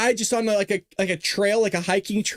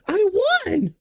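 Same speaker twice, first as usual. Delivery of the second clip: squeaky, very high creature voice; a whiny little golem croak; mock Hillary golem; 11 semitones higher than usual. Host mocks Hillary as woods golem, squeaky.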